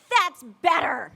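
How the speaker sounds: the coach approving the player's respectful reply; firm satisfied coach. Retold or imitated. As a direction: settle it like the one in charge. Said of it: "And she's like".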